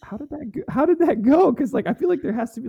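high, loud disbelief; shocked and curious; surprised. Real, not acted. Amazed question about sext.

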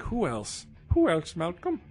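mock old lady, pitch rising high; lightly put-on old lady with a French Canadian lilt. Son quizzed by his old mother, mock old lady voice. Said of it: "She has this real, like, old lady".

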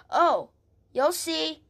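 normal talking voice

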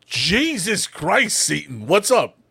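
high, loud, drawn-out shock; startled yelp. Retold or imitated. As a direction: imitate coworkers jumping in alarm. Startled coworkers reacting to deep voice.

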